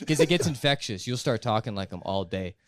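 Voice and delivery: ordinary talk, nothing marked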